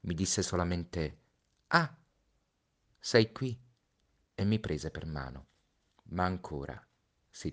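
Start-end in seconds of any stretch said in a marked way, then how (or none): 1.7-3.6 put-on light, higher child's voice, earnest; a small boy warning of the snake; Little Prince voice. (voce del Piccolo Principe, più acuta)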